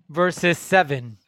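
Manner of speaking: usual speaking voice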